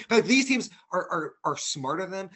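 sudden loud burst of frustration; heated outburst. Real, not acted. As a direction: punch it out, exasperated. Heated argument about front office.

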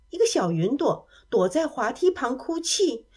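ordinary delivery

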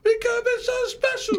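high squeaky movie-villain impression; Rush Hour movie voice. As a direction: pitch it way up like the Rush Hour line. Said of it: "Oh, Rush Hour".

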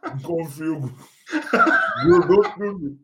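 talking through sticky paçoca; words stuck in a full mouth. Real, not acted. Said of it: "tentando falar com a boca cheia de paçoca".

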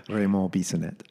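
name said with a French accent; French-accented name, softly demonstrated; French pronunciation. Shown as a demonstration. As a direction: say your own name the French way. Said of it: "how do you say it then".

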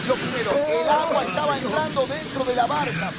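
forceful, incredulous pulpit questioning; heated preacher's challenge; full-force preaching. Acted. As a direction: press the point loudly on the congregation. Preaches at full force over music.

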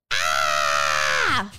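low loud cry of pain; mock scream of pain; pained yell. A narrator's reading. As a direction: cry out as you're cut. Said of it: "yelled Michael".

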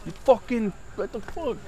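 strained high mock yell, held back in volume; imitated yelling. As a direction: mimic an angry holler in a high, contained voice.

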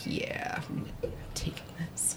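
hushed unvoiced whisper. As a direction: whisper it so no one hears. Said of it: "They're like".

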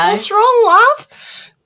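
very high, loud Aussie husband; squawked mock-Australian question; Australian accent. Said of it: "In that exact accent".

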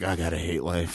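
low, very soft hungover grumble; mock misery. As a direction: imitate a spent, miserable mumble. Acting out next-day hangover misery.

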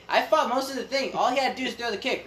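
usual speaking voice

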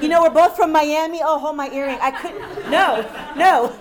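amused protest over own laughter; laughing joke. Real, not acted. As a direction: crack up while joking about a fight.

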